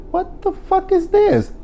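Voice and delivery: squeaky high, slow what the fuck; a high, drawn-out squeal of disbelief; shrill disbelief. Retold or imitated. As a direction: shriek it out in stunned disbelief. Speaker's own quoted reaction.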